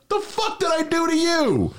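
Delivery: very high-pitched indignant retort; mock angry outburst. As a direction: replay being affronted by a cat. Acts out yelling at cat.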